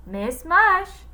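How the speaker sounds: high, bright-pitched call through the door; cheery cook. A narrator's reading. Cook calling through the classroom door, high. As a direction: announce yourself cheerfully. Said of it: "said Miss Mush".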